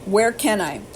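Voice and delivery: ordinary talk, nothing marked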